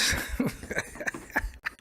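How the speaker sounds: soft unvoiced laugh; breathy laugh. Real, not acted. Streamer laughs at the beige rage joke. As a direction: laugh quietly to yourself.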